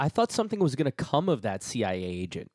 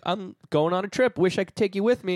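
mock breezy mom; 7 semitones higher, faster than usual. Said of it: "she was like".

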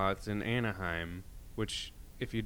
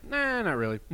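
indifferent cop; 11 semitones higher, 4 dB louder than usual. Retold or imitated.

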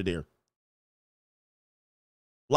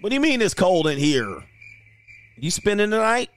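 incredulous; 7 semitones higher than usual. Retold or imitated. Acted incredulous reply.

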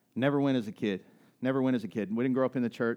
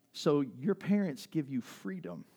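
ordinary talk

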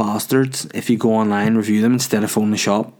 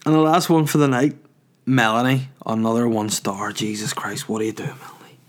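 normal talking voice

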